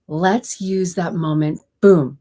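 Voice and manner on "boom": a sudden loud punch on one word; a speaker coach showing how to land a key beat; emphatic. Shown as a demonstration. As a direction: hit it hard to mark the moment. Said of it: "Here's the emphasis".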